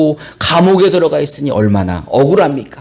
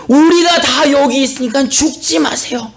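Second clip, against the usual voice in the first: raised, high urgent call; calling out urgently; 7 semitones higher than usual. Acted. Paul to the jailer about to kill himself, urgent raised call. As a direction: call out to stop him. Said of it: "갑자기 소리를 지르는 거예요".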